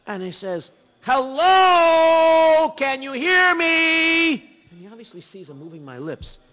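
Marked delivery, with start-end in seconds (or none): 1.5-4.3 very loud, high, drawn-out scream; screaming at an old man with plugged ears. Retold or imitated. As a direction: bellow it slowly across a noisy hall. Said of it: "I give a geschrei".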